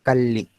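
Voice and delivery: "Calle" as cal-li, L held across syllables; long voiced L. Shown as a demonstration. Teacher repeats own region's form, "Calle" with long L. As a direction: linger on the double L of "Calle". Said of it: "Nosotros lo diríamos Calle".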